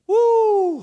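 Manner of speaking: high, loud joyful whoop; real shouted woo of praise; excited whoop. Real, not acted. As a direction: let out a big praise whoop. Preacher's exclamation.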